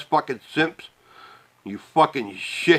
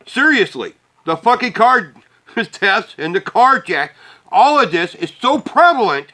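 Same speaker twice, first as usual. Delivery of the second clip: heated rant; 5 semitones higher, 4 dB louder than usual. Real, not acted. Ranting about car thefts.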